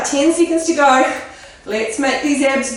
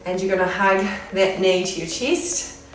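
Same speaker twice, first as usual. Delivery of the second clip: soft, calm cool-down voice; calm stretch cues; 2 semitones lower, 4 dB quieter than usual. Acted. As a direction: ease them into the stretch.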